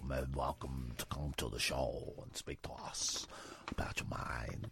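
slow announcer read sinking into a whisper; radio plug fading to whisper. Acted. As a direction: read the number then whisper away. Phone-number plug fading to whisper.

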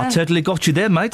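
put-on South African accent. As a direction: gloat like a South African prankster. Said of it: "I'm doing a South African".

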